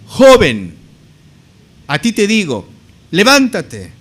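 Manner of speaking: loud, measured command with pauses. Acted. Jesus ordering the dead young man to rise, loudly commanding. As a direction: proclaim each phrase as a forceful order.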